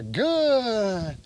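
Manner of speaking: GOOD stretched long and falling; drawn-out duration marker. Real, not acted. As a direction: hold "good" out slowly to the dog. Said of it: "Duration marker".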